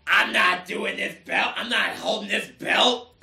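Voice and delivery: lightly voiced wrestler impression; a hint of Seth Rollins. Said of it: "let me put on my best Seth Rollins voice".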